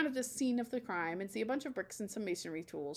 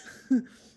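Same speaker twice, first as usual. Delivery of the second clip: loud laugh; 4 semitones higher, 11 dB louder than usual. Acted. Kids laugh at teacher.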